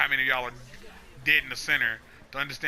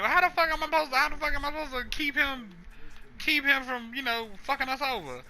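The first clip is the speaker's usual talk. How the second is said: high-pitched exasperated whine; 11 semitones higher, faster than usual. Acted. Blaze asking how to hold the monster off, high exasperation. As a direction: throw it up high in frustration. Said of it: "And he's like".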